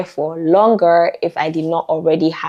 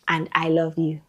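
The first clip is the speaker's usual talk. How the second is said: ordinary talk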